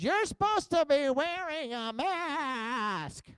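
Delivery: high, drawn-out mocking screech; exaggerated slow, high nagging wail; scolding store lady. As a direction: imitate a scold, stretching every word. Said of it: "That's the way she sounded".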